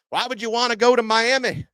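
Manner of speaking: mock a rival SEC coach in a thick Gator accent. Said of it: "Gator accent to any hypothetical coach".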